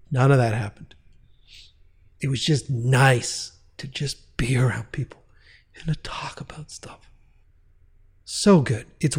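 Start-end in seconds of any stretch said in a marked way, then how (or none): 2.2-7.1 soft, unvoiced whisper. Real, not acted. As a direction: breathe it out fondly, almost a secret. Fond memory of being around people.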